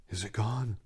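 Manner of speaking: soft, hushed question; barely above a whisper, afraid to ask. Acted. Servant whispering to his stricken master, hushed. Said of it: "he whispered".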